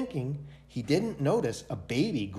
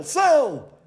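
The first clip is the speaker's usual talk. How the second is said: much higher, louder shout of the word; businessman shouting; 13 semitones higher, 8 dB louder than usual. A narrator's reading. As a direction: push it up to a full trader's shout. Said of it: "He said things like".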